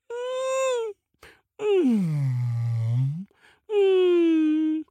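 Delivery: high, long wordless wails; fish crying. A narrator's reading. Fish stranded on the mountain, high crying wail. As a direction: wail like a miserable big fish.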